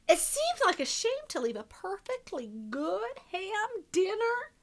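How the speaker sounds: sly wolf narrator, hushed then punchy; storybook reading. A narrator's reading. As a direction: tell it to children as the wolf, confiding and playful. Continues the wolf's story.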